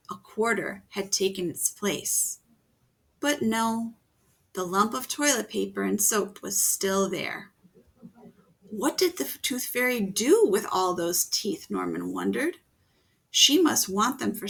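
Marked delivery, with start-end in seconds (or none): none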